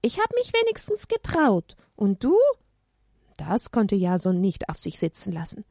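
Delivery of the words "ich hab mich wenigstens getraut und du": put-on high, taunting child; childlike dare, high and pert. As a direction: tease like a bold little kid.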